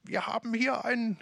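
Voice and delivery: high put-on cartoon voice; mock ad characters. Preacher retelling the Ricola ad, in a high mock voice. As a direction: voice the ad figures stirring the pot. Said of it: "die rühren gerade ... und sagen".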